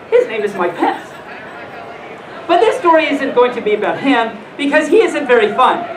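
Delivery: ordinary talk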